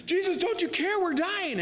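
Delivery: very high, hammed-up panicked wail; freaking out. Disciples coming to Jesus as they fear drowning, freaking out. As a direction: squeal it in mock panic. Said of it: "But they were freaking out".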